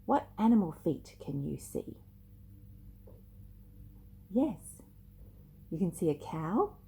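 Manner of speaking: usual speaking voice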